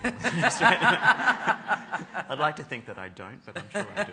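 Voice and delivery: real laughter carrying the whole admission; laughing. Real, not acted. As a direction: giggle the words out at your own expense. Admits own Australian accent.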